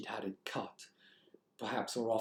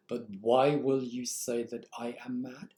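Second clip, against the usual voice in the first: ordinary talk, nothing marked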